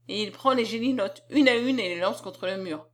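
ordinary delivery

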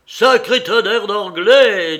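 loud, high, drawn-out curse; captain swearing at the enemy; angry outburst. A narrator's reading. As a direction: erupt in a sailor's oath. Captain curses the English suddenly.

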